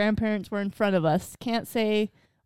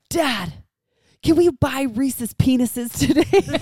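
put-on higher, loud, drawn-out child's request; loud declaration; 6 semitones higher, 7 dB louder, much slower than usual. Three-year-old asking dad at the grocery checkout, loudly declaring. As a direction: proudly announce it to the whole store. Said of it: "and loudly declared".

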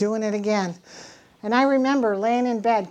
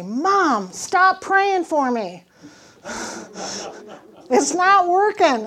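higher, louder, slow desperate son; pleading son; 7 semitones higher, 7 dB louder, slower than usual. Retold or imitated. Son on the phone to his mother, loud higher plea. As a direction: push back like a worn-out son.

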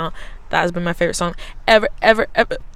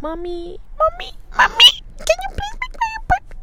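put-on much higher whiny child voice; whiny little kid. To her mom, begging in a high child voice. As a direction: beg like a small kid. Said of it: "And I was like".

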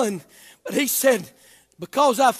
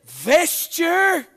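building from measured to shouted preaching; fiery Baptist preacher shouting the gospel; preaching at full force; 8 semitones higher, 4 dB louder than usual. Acted. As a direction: whoop it up to the pulpit.

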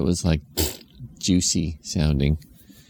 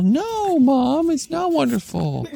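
put-on child voice; slower than usual. Kid protesting to mom, high voice.

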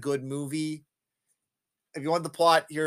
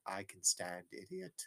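low, muted retort; 8 semitones lower, 15 dB quieter than usual. Retold or imitated. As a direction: imitate a cool, understated character. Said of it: "like, he's like".

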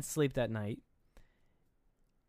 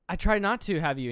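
higher, louder protest; lightly acted outburst, pitched up; acted confession; 6 semitones higher, 6 dB louder than usual. Imitating mother confessing to her son, raised voice.

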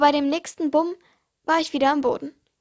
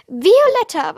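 loud, higher call of the name; 5 semitones higher, 9 dB louder, slower than usual. Acted. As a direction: call her back from afar. Owner calls out the pet's name.

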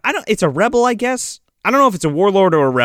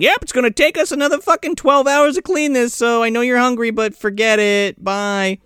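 higher-pitched singsong dismissal, easing down; mock brush-off; 6 semitones higher than usual. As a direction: wave them away in a put-on voice. [name]'s acted brush-off to ogre.

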